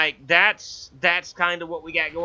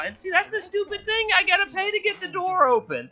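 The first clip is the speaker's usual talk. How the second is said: high-pitched complaint. Real, not acted. Mock outrage at paying door.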